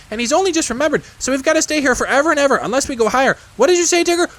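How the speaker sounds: put-on very high, loud excited child-creature; excited little Roo. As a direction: squeal it all out in delight.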